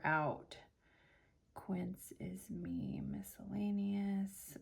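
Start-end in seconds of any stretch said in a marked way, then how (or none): 1.5-4.6 whispering. Real, not acted. Whispering while sorting receipts.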